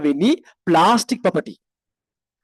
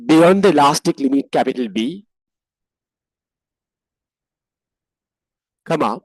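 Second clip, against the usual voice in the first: dictating; 2 semitones lower, 3 dB louder than usual. Shown as a demonstration. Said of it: "write like this".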